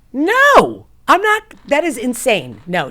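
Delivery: high, loud outraged no; indignant refusal. Retold or imitated. Refusing the halfway house on the phone, loud and outraged. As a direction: shout the refusal, appalled.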